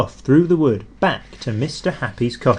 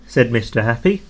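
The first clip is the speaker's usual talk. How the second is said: ordinary delivery, nothing marked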